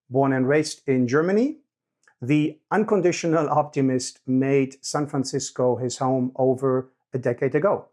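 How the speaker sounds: loud, measured presenter read; scripted podcast introduction. Real, not acted. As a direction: read the guest bio aloud to podcast listeners.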